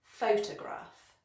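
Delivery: PHOTOGRAPH said slowly as PHO-tuh-graf; first-syllable stress. Shown as a demonstration. Teacher models stress and schwa, slow PHO-tuh-graf. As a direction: draw out "photograph", leaning on PHO. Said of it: "stress on the first syllable".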